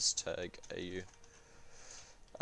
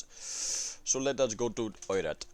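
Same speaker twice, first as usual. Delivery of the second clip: a light put-on Indian accent, drawn out; 2 semitones higher, slower than usual. Streamer touring the map in a mock Indian accent. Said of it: "This is Indian accent for me".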